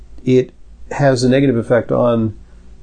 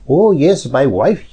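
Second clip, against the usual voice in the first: put-on strong Indian-Pakistani accent, pitched higher; 4 semitones higher than usual. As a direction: imitate the cab driver in a thick Indian-Pakistani accent. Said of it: "the strong, whatever, Indian, Pakistani accent".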